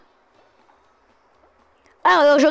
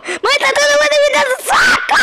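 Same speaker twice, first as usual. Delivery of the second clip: hit hard crying out; 11 semitones higher than usual. Real, not acted. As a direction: yell it out while getting punched. Crying out while being hit.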